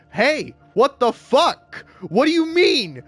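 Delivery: high, drawn-out outraged yell; pissed-off confrontation. Acted. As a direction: confront the wife in loud disbelief. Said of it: "I'd be so pissed".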